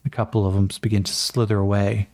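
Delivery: normal talking voice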